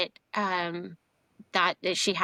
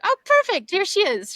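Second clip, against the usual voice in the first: much higher and louder, thrilled cry; delighted exclaim; 12 semitones higher, 7 dB louder than usual. Retold or imitated. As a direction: burst out like she just found the treasure. Grandmother finds the statue, delighted.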